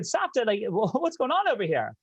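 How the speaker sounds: high-pitched, laughing disbelief; playfully baffled question, pitched well up; mock bewilderment. Grandson asks grandmother about all the Russians, amused bewilderment.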